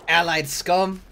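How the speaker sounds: ordinary talk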